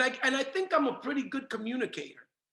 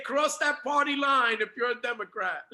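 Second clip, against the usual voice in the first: ordinary talk, nothing marked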